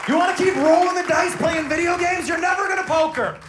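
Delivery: high, loud comic rant; worked-up dad lecture. Retold or imitated. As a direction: escalate into an exasperated fatherly shout. Mock dad lecture building up.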